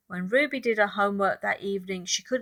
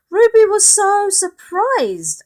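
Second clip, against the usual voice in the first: amazed narration; 12 semitones higher, 10 dB louder than usual. A narrator's reading. Narrator stresses surprise.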